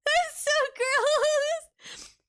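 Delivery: high, slow, squealed through laughter; laughing. Real, not acted. Laughing at her own gross joke to chat, squealing laugh. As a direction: giggle it out, high.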